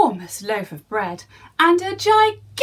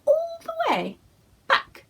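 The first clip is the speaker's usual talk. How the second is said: whooshing high. A narrator's reading. End of the slide.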